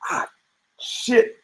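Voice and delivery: under-the-breath ah, shit, pitched high; whispered curse. Retold or imitated. Conor seeing his trash talk fail, whispered curse. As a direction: whisper it like a man caught out.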